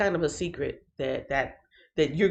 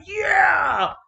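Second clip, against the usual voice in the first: joyful shout; 12 semitones higher, 4 dB louder than usual. Shown as a demonstration. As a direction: shout it out with joy. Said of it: "rejoice like".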